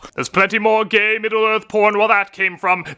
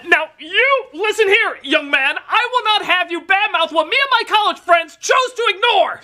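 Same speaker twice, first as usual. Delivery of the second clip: mock scolding elder; 14 semitones higher than usual. Scolding co-host like a parent.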